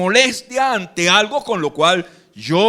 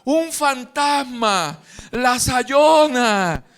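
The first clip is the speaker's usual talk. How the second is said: slow high drawn-out scared cry; hammed-up panic of the disciples; mock frightened cry; 6 semitones higher, much slower than usual. Disciples in the boat seeing a ghost, hammed-up scared cry. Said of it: "empezaron a gritar".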